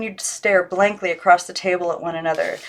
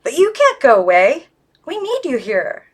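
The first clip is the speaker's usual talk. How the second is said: higher pitched, pleading Miss Christabel; a doll begging her friend to stay, up high; 8 semitones higher, 4 dB louder than usual. A narrator's reading. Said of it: "she said in a high voice".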